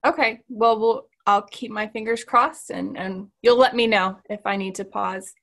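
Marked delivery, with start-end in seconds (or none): none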